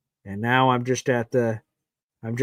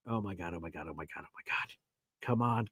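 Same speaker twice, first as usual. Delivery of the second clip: barely voiced, much softer repeated gasps of oh my god; hushed disbelief; 9 semitones lower, 14 dB quieter, faster than usual. Real, not acted. Fan stunned watching tense play, hushed whispered disbelief. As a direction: breathe it out almost silently, stunned.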